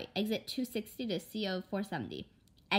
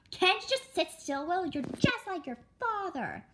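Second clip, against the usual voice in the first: much higher, louder complaint; exasperated scold; 11 semitones higher, 4 dB louder than usual. Acted. Mother snapping at her restless son in the car, exasperated. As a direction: snap at the fidgeting child.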